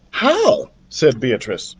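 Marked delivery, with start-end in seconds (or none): 0.1-0.7 eager question. A narrator's reading. Beatrice asks eagerly.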